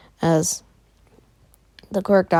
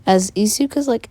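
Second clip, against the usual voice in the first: ordinary delivery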